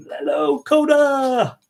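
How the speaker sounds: high, drawn-out hello to a dog; pet talk. Real, not acted. Owner saying hello to the dog, high pet talk. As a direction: coo a long, high greeting.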